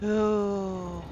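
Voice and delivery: normal talking voice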